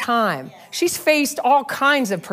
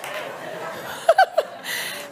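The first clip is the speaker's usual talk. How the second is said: high breathy laugh; 6 dB quieter than usual. Real, not acted. Laughing at her own joke.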